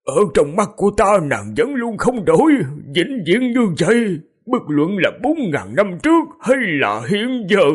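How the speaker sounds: high, impassioned lament; choked with grief. A narrator's reading. King tells his dead beloved she never changed, high grieving voice. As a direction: declare devotion through grief, pitched high. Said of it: "Khương Thái Hương nghẹn ngào, lẩm bẩm".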